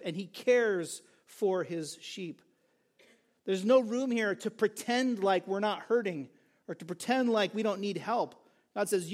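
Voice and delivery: ordinary talk, nothing marked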